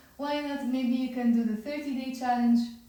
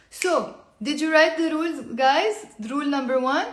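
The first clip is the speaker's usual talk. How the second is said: calling out; 3 semitones higher, 8 dB louder than usual. Real, not acted. Calling out to viewers again.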